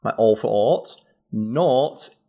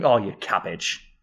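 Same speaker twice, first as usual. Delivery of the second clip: affectionate; 3 semitones lower, slower than usual. Shown as a demonstration. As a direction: say it like a term of endearment. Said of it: "based on how it's delivered".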